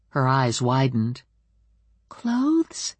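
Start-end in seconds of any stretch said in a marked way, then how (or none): none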